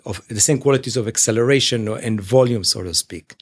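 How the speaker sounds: normal talking voice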